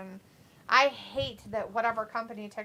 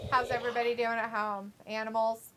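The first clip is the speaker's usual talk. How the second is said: ordinary talk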